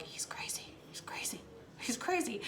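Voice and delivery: hushed, barely voiced mock whisper; very soft retold whisper. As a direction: whisper it like a bystander behind his back. Retold bystanders murmuring about him.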